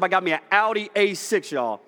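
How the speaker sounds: usual speaking voice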